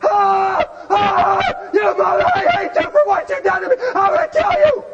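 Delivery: loud, high mock scream rising to a shriek; screaming at husband. Wife in the kitchen screams at her husband, loud and high. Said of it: "she's screaming at Mitchie".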